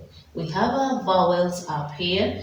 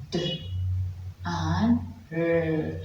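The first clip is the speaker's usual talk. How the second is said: normal talking voice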